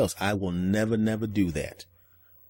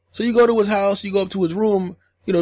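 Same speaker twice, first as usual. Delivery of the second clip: ordinary talk, nothing marked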